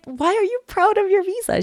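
very high disbelieving question; incredulous. Retold or imitated. Asking Maya why she is proud, incredulous. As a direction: squeak it in disbelief.

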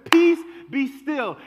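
loud and slow, a command; commanding to the storm. Acted. Jesus speaking to the storm around us, loud slow command. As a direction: bark it at the raging storm. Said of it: "he's talking to the storm".

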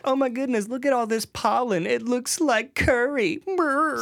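high, slow comic voice; silly high joke voice. Retold or imitated. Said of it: "making jokes in the car".

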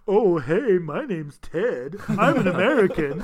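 drawn-out, exaggerated American accent; mock American accent. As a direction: Brit badly imitating an American. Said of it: "do you think they ever be like".

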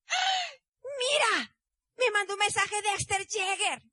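high, excited cry of surprise at a message; excited husband. Retold or imitated. Husband reading a text from his idol, excited high cry.

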